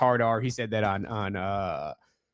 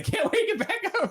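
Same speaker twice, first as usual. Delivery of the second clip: laughing; faster than usual. Real, not acted. Laughing at sleeping chicken.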